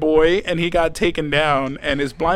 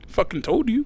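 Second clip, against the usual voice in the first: ordinary talk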